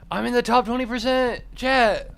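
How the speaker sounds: high, loud thrilled yell at chat; excited shout. Real, not acted. Streamer yelling to chat about his ranking, thrilled shout. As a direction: shout it out, over the moon.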